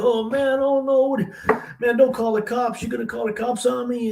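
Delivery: high-pitched retold begging; scared kid's plea, voice way up; pleading. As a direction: plead in a panic not to be turned in. Caught boy begs the store owner.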